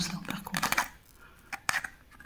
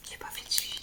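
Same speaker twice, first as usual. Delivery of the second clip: breathy whispered admission, almost to herself; 16 dB quieter, much faster than usual. Real, not acted. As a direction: whisper it as a quiet confession. Repeats admission, then whispers it.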